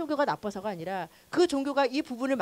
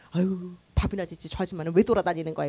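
mock scornful grumble; 6 semitones lower than usual. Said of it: "이러고 막 엄청 욕했다니까요".

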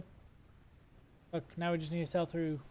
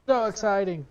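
mock excitement; 9 dB louder than usual. Mock excitement about sailing.

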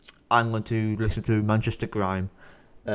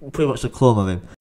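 usual speaking voice

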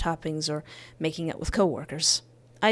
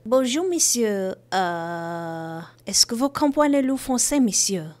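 mock French; 3 semitones higher than usual. Dodging her ex on the phone, mock French. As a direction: put on a fake Frenchwoman to fool him. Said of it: "Start talking in an accent he doesn't understand".

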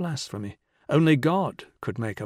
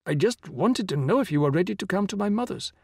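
higher-pitched, backing-down plea; meek explanation in a raised pitch; intimidated; 7 semitones higher than usual. A narrator's reading. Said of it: "Murugan changed his tone".

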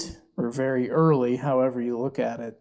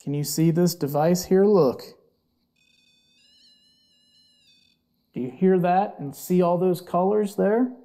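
coaxing the spirit, a bit louder; talking to a ghost; 5 semitones higher, 5 dB louder than usual. Real, not acted. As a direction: ask the spirit gently to show itself.

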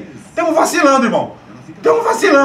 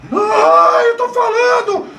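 panicked yell; 6 semitones higher, slower than usual. Real, not acted. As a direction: yell at the defense. Fan yelling in panic at defense.

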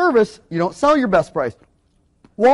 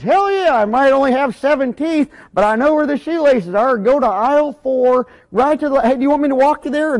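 toothless greeter; 5 semitones higher, 3 dB louder than usual. Retold or imitated. Toothless greeter eagerly directing a customer, high and loud. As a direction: burst out like a proud helpful greeter. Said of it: "minimum wage toothless greeter".

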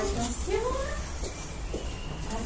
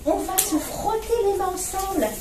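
ordinary delivery, nothing marked

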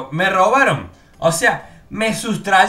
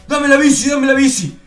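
thug demand; 8 semitones higher, 6 dB louder than usual. Retold or imitated. Thugs demanding his bike.

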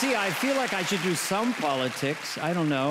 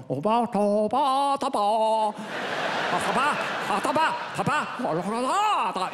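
crazy gibberish character; 3 semitones higher, slower than usual. Retold or imitated. Young Adam pitching a sketch to Dana, crazy gibberish. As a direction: go crazy with silly babble. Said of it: "a guy that kinda goes".

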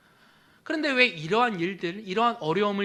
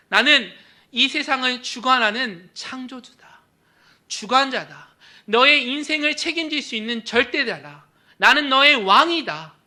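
proclaiming; 6 semitones higher, 10 dB louder than usual. Acted. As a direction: proclaim divine authority. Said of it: "예수님께서 선포하시는 거예요". (예수님이 왕으로서 크게 선포하며)

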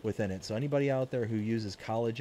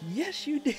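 teasing. Retold or imitated. Teasing reply in retold story.

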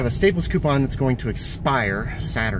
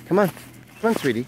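calling the dog; 7 semitones higher, 4 dB louder than usual. Real, not acted.

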